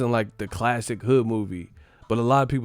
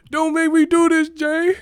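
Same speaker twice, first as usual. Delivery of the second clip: much higher, louder pleading quote; pleading movie cop; 7 dB louder than usual. Retold or imitated. Mimicking the cop begging Jay, high and loud. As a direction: plead way up high, half joking. Repeating the movie standoff line.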